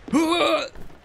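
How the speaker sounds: loud high gasp; sudden high-pitched gasp; startled gasp. Real, not acted. Startled by the game.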